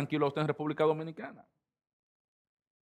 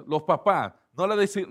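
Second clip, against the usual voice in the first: normal talking voice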